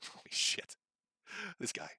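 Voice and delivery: unvoiced disbelieving whisper; a hushed holy shit. Real, not acted. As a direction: breathe it out in disbelief. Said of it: "quiet disbelief".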